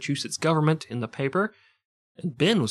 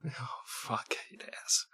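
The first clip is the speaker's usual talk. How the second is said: whispered dismay; real whispered dismay; 15 dB quieter, slower than usual. Real, not acted. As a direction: whisper it in shock.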